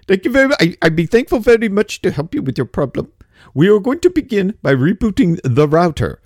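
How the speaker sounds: put-on chirpy Indian-accented tech support reading a script; polite Indian-accented agent voice, hammed up. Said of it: "they got the accent".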